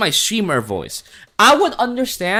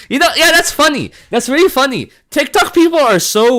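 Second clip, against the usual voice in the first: high, loud, tickled reaction; amused outburst; 11 semitones higher, 5 dB louder than usual. Real, not acted. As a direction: burst out amused.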